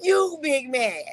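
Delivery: laughing taunt. Real, not acted. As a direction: tease him while laughing.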